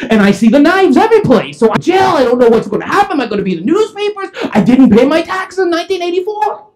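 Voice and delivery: high, loud hysterical ramble; escalating panic. Retold or imitated. Calvin explaining the weapons to Vince, escalating hysteria. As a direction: spiral into a shrieked confession.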